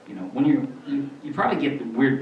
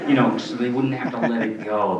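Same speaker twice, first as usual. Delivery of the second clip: ordinary talk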